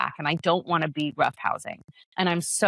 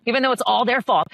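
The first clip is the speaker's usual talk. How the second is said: mock blame with levity; 6 semitones higher, 4 dB louder, faster than usual. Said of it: "I say this with levity".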